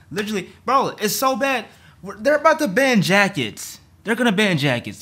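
high, loud, incredulous rant; loud venting to a bro; exasperated outburst. Real, not acted. Upset rant about jacket ban.

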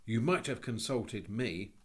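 slow, loud, aggrieved reproach; sulky schoolboy complaint; bitter boy. A narrator's reading. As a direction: stress the grievance resentfully. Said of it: "was the bitter rejoinder of Robert".

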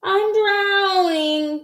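high, drawn-out wail; panicked cry. A narrator's reading. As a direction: wail it like a child in trouble. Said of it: "cried Froggy".

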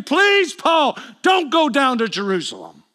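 high plea peaking on "Please", then dropping; acted desperate appeal; impassioned begging. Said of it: "and I begged him".

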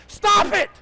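very high, very loud command; yelling. Real, not acted. Pastor in your boat, yelling warning. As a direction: yell to halt them in their tracks. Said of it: "put in your boat to yell at you".

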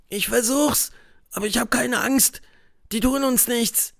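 put-on a boy calming his friend; boy's voice. A narrator's reading. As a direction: play a boy putting on courage. Tom answering Huck.

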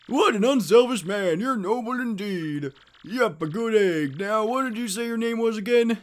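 admiring village mayor. Acted. Mayor praising an unselfish hero, effusive.

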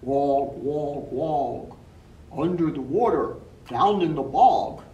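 low loud croaky frog. Acted. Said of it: "sang the frog".

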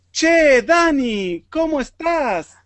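upbeat model greeting for students; cheerful greeting demo. Shown as a demonstration. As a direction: greet her like a buddy, drawn out. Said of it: "model greeting".

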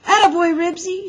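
high, loud, encouraging shout; delighted shout of praise. A narrator's reading. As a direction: cheer the dog on loudly. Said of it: "shouted Henry".

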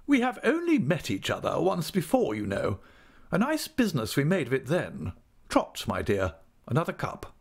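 high, commanding aunt voice; brusque aunt, matter-of-fact. Acted. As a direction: talk briskly and give orders over tea. Aunt chats over tea.

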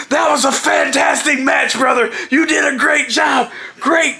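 high, shouted praise; hearty congratulations. Retold or imitated. Steiner hugging him in the dressing room, imitated excited praise. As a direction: imitate a pumped-up wrestler praising a partner.

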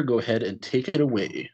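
normal talking voice

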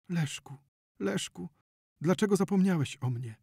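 mournful high-pitched lament; tearful pleading. A narrator's reading. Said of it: "powtarzała, zalewając się łzami".

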